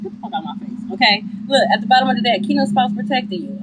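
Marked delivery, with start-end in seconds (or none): 0.0-0.9 unvoiced muttered aside; whispered. Real, not acted. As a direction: hiss it under breath.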